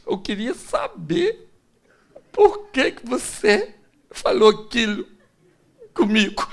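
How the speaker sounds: high, halting mock-wounded wife; hurt wife. Wife asks husband why he said that, mock-hurt. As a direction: imitate a wife demanding an explanation.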